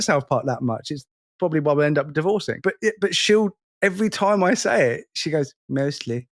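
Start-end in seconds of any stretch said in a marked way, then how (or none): 5.7-6.3 light, soft Cartman "Mostly."; a hint of Cartman, quietly. Wife answering husband's line, light Cartman voice. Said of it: "she'll do it in the Cartman voice".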